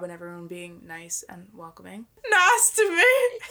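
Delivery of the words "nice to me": laughing plea. Acted.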